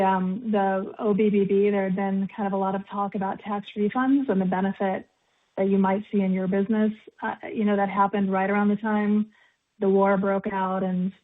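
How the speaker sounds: ordinary delivery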